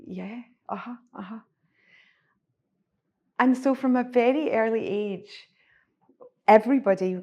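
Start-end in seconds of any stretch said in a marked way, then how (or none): 0.1-1.4 small, subdued agreement; hushed, hesitant yes from a frightened kid; scared child agreeing. Acted. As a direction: agree softly like a cowed child. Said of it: "And as a child, we go".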